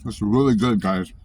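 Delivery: talking through a mouthful; words round a bite of food; mouth full. Real, not acted. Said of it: "don't mean to talk with my mouth full".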